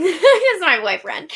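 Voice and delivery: real giggles breaking through the words; laughing. Real, not acted. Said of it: "sorry, I just laughed at something".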